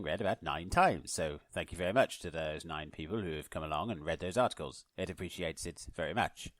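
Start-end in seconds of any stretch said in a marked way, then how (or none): none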